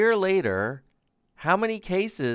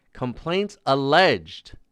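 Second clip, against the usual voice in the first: ordinary talk, nothing marked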